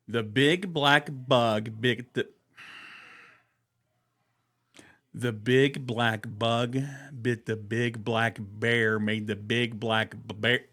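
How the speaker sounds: slow, deliberate articulation of each word; slow careful drill. Shown as a demonstration. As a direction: spell out every syllable slowly. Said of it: "These are vocal exercises".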